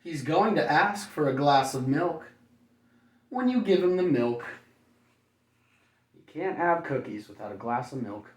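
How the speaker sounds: ordinary talk, nothing marked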